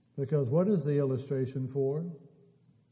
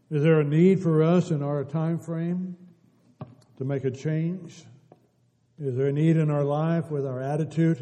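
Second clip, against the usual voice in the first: full-force preaching; 2 semitones higher, 7 dB louder than usual. Acted. Preacher proclaiming God's open invitation to every individual, loud full-force preaching. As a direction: ring it out to the whole church.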